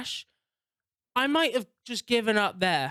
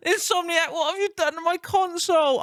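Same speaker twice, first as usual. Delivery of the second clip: high mock-anguished cry to the heavens; anguished outcry; 9 semitones higher, 3 dB louder than usual. Real, not acted. Gamer blaming Insomniac for the dead console, high anguished cry. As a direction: wail it up at the developers. Crying out at the developer.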